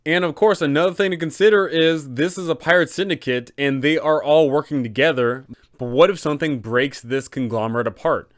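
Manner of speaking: ordinary talk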